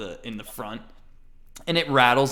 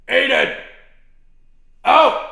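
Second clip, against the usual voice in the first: very loud high call; yelling for kid; 13 dB louder than usual. Acted. Shopkeeper yelling for Aiden to come out. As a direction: bellow across the shop.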